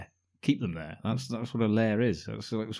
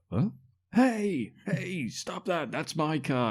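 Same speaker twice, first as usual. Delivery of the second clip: high-pitched mock protest, half-hearted yell; mock shout; 8 semitones higher, slower than usual. Retelling the film, he mimics Jack yelling at the thugs. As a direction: cry out to stop the thugs, high and strained. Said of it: "And then he shouts".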